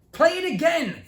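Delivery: very high, loud demand; boy shouting at his sister to replay the record. A narrator's reading. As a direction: yell it at full pitch. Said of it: "Theo shouted".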